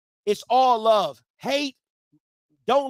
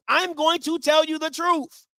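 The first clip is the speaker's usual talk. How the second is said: normal talking voice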